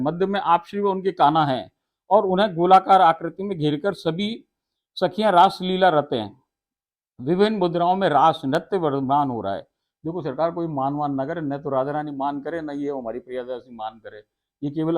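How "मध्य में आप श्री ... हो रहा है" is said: raised, loud, solemn telling of the raas; devotional recitation. Acted. Preacher reciting the raas scene to devotees, loud and solemn. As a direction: recite the holy scene to the listeners.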